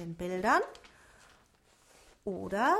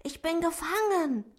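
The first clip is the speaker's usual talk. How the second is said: higher, excited rabbit voice; 8 semitones higher, 4 dB louder than usual. A narrator's reading. Lino the rabbit greets Otto, excited and higher. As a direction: gasp his name in excitement. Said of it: "flüsterte Lino ganz aufgeregt".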